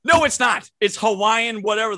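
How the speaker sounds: loud, high, exasperated correction; indignant rebuttal. Real, not acted. Co-host calling out the broken corn promise, indignant outburst. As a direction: throw the contradiction back loudly.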